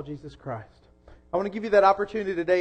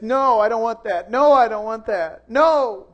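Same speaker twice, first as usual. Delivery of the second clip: loud, higher mock toddler tantrum "No, I don't want that"; imitated toddler screaming; 4 semitones higher, 8 dB louder, slower than usual. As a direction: scream it back like a two-year-old refusing everything. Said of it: "she just screams and screams".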